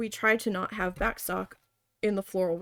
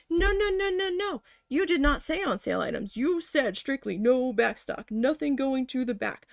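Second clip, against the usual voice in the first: high, rapid-fire string of noes into firm accusation; indignant refusal; 4 semitones higher than usual. Retold or imitated. Retelling contradicting the boss about sale items, indignant. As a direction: cut the boss off in protest.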